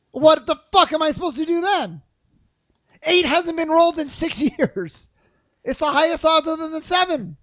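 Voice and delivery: loud, high outburst of frustration; exasperated rant. Retold or imitated. Player griping about Catan dice never rolling, exasperated.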